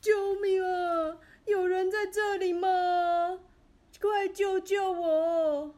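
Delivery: loud, high, slow drawn-out cry for help; shouting for help. A narrator's reading. Calling again from the pit to new footsteps, slow loud desperate shout. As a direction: stretch every word up toward the opening, near tears. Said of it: "连忙又大喊".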